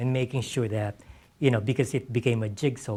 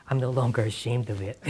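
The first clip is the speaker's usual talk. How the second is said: relieved laugh while quoting himself; 6 semitones higher, 4 dB louder than usual. Retold or imitated. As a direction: say it through a laugh. Quoting his own answer, laughing.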